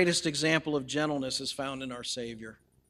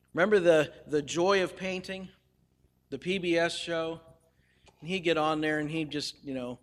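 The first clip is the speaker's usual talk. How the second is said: ordinary talk, nothing marked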